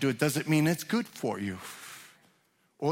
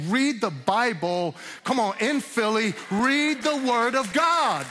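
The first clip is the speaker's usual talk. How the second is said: raised, high preaching cry; impassioned exhortation; 7 semitones higher than usual. Real, not acted. Preacher urging his Philly congregation to read scripture, impassioned. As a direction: build to a drawn-out, ringing command.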